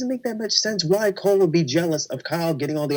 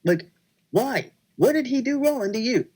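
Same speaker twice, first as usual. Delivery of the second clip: mild pleading mock scream; 8 semitones higher than usual. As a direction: replay disbelief in a high, contained voice. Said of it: "I'm screaming myself".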